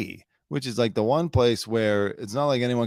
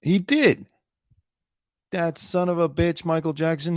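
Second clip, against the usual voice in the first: mock outrage; 8 semitones higher than usual. Mock outburst about Jackson.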